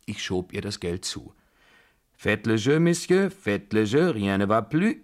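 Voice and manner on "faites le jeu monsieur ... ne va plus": loud French table announcement; projected, lilting French summons to play; croupier's call. A narrator's reading. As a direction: call for bets over the room. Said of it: "rief der Croupier zum Setzen auffordernd".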